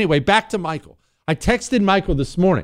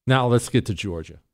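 ordinary talk

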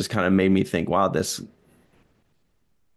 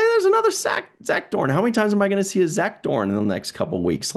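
higher-pitched incredulous retelling; mock exasperation; 10 semitones higher than usual. Viewer spotting yet another Zach Dorn, mock exasperated. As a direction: pitch it up in playful disbelief. Retelling own surprised reaction to repeated alien.

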